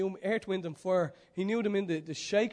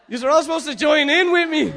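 loud, high preacher call to the crowd; rally the crowd at full force; full-force preaching; 10 semitones higher, 19 dB louder than usual. Real, not acted. Preacher urging the congregation to join in, loud and high.

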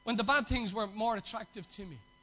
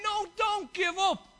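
higher, louder and slow; urging plea; 9 semitones higher, 6 dB louder, slower than usual. Retold or imitated. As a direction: plead with a friend not to quit. Voicing the team encouraging.

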